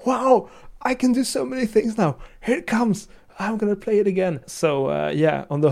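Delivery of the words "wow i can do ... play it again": put-on delighted kid voice. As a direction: gush like a thrilled child over a new card. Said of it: "He was just like".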